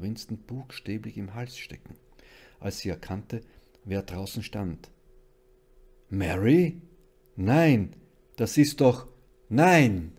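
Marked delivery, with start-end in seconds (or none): 6.1-10.1 loud, shocked cries; stunned, broken-up exclamations, very loud; horrified disbelief. A narrator's reading. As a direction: cry out in horror at the impossible. Mother sees dead daughter at door.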